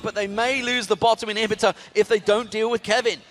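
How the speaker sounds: high-pitched live esports commentary; excited play-by-play. Real, not acted. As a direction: call the fight as it unfolds, pitch held up. Live play-by-play at action pace.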